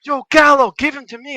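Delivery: high, loud yell across the ring; retold shout. Wrestler shouting from mid-ring to the narrator, loud and high. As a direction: call out demanding the opponent. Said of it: "and goes".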